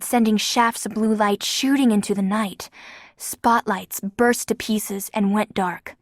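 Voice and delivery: plain reading voice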